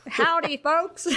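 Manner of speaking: high, hammed-up cowboy greeting; mock cowboy howdy.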